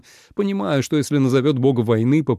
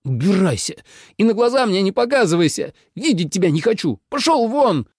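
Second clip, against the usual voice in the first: high, mounting enraged shout; furious dismissal; 9 semitones higher than usual. A narrator's reading. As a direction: order him out through grinding teeth. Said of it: "Минлу заскрипел зубами". (в ярости, высоким голосом)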